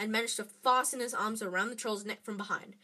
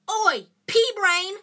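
loud, high, drawn-out heckle; bellowed insult to get attention; taunting yell; 10 semitones higher, 12 dB louder, slower than usual. A narrator's reading. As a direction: yell it across the room. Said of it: "yelled Ron from the other side".

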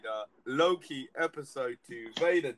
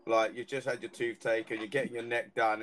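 ordinary delivery

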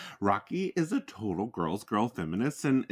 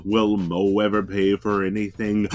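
loud, building announcer voice; dramatic announcer outro; 10 dB louder than usual. Acted. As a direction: tease the next episode big.

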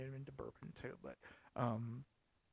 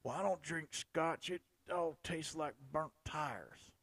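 mocking a scotch hater; 4 semitones higher, 5 dB louder, slower than usual. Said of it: "people that say".

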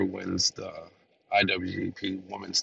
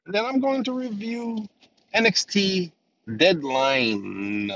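ordinary delivery

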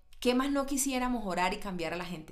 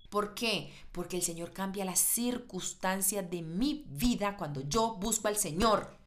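ordinary delivery

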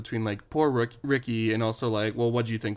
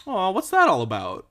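higher cooing pet voice; talking to the cat; 8 semitones higher, 4 dB louder than usual. Retold or imitated. Imitating her friend cooing at the cat Linus. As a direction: coo at the cat like a baby. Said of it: "you looked at Linus and you were like".

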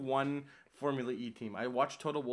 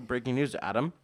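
louder joking headline call; mock breaking news; 2 semitones lower, 4 dB louder than usual. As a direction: tease him like a news anchor. Mock news announcement to friend.

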